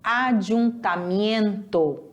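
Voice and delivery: Ayuntamiento, slow and spelled out; Spanish pronunciation. Shown as a demonstration. Teacher modeling a new Spanish word slowly. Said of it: "em espanhol se fala ayuntamiento".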